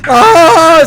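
loud, high sudden yelp; real surprise; startled gasp. Real, not acted. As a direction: jump at the sight. Sudden figure appears in game.

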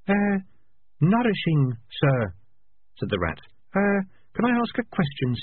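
put-on high, nervous young rat; a hesitant recruit speaking up. A narrator's reading. New recruit Nourishing answering her sergeant, high and nervous.

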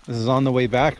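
normal talking voice

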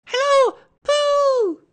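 put-on very high, loud childlike squeak; excited squeak. A narrator's reading. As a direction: squeal the greeting out at full volume. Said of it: "he squeaked".

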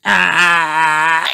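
high little ah; open-mouthed ah. Acted. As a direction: open wide and go ah. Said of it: "says George".

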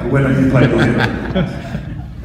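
slowed by a real laugh; chuckling through the words, drawn out. Real, not acted. Explaining he plays the mix for Paul, laughing.

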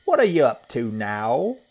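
high, loud monkey question; Mugglewump. A narrator's reading. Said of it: "said Mugglewump".

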